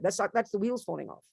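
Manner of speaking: ordinary delivery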